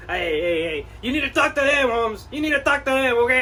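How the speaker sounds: put-on laid-back Chicano street accent, ese and vato. Collector deflects.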